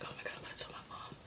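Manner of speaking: rushed nervous whisper. Retold or imitated. Daughter about to tell her mom, nervous. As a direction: whisper it fast and very softly, nerves taking over. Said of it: "It was definitely a nervousness".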